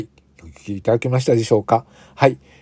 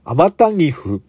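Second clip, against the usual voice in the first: backwards speech; 4 semitones higher, slower than usual. Shown as a demonstration. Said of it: "逆さまに再生してみましょう".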